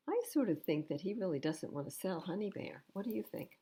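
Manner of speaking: plain reading voice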